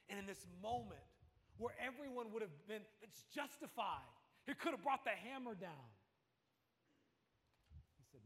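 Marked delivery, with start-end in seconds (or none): 0.1-5.9 higher, driving sermon build; preacher building to the climax; rising preaching. Acted. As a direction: build the tension to a peak.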